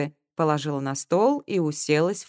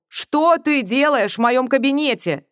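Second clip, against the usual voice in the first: loud, slow, raised challenge; angry demand; 8 semitones higher, 6 dB louder, slower than usual. A narrator's reading. Man catches her in his office, angry demand. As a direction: confront an intruder, stressing each word.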